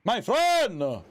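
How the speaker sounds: high, drawn-out, hyped call; excited greeting. Acted. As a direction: belt out a cheery welcome. Streamer's hyped opening greeting.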